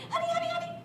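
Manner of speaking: very high, quick honey-honey cries; very high scared cub voice; panicky little bear. Acted. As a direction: squeak it out like a stuck cub. Stuck bear runs around.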